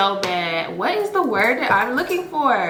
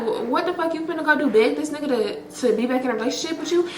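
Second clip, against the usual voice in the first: retold disbelief; 6 semitones higher, faster than usual. Incredulous reply to friend.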